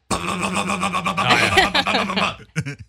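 high stuttering hum, mmm-ma-ma; wife's sputter imitation. Husband imitating wife lost for words, sputtering. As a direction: imitate her stuck on wordless sounds. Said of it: "she's like this".

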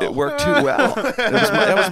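high loud laughing voice. Real, not acted. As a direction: laugh while repeating it. Laughing at own story.

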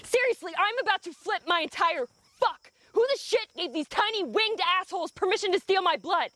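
high-pitched exasperated outburst; fed up and fuming at the mosquitoes, pitch riding high; exasperated rant. Acted.

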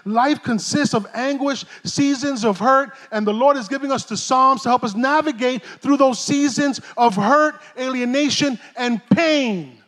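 normal talking voice

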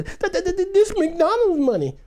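put-on high, stammering child's voice; scared little boy. Little boy at the register during robbery, panicked stammer. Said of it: "little boy".

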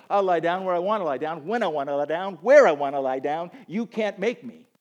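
loud, insistent, defiant protest; hammed-up stubborn sheep refusing orders; proud sheep. Proud sheep refusing the shepherd, loudly defiant. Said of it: "in my pride as a sheep".

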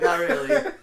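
high laughing answer. Retold or imitated. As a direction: giggle it out. Laughing while retelling a friend.

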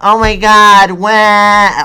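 high, loud hammed-up wailing; mock baby wail. Diner mocking a crying baby at a restaurant, loud mock wail. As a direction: fake a crying baby badly. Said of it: "I don't really have a baby crying impression".

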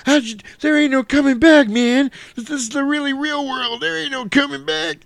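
very high, panicked repeating; shrill panicked ranting about no coming back; frantic high thug. Retold or imitated. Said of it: "He's like".

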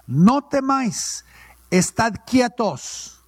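No temáis, estad quietos drawn out very slowly; slow, deliberate reading. Shown as a demonstration. Preacher rereading the verse to the congregation, slow and deliberate. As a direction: let each word of No temáis sink in slowly. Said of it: "Aquí lo dice".

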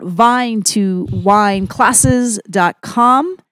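normal talking voice

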